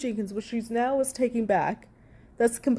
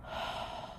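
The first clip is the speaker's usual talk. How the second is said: exasperated sigh; 14 dB quieter than usual. Real, not acted.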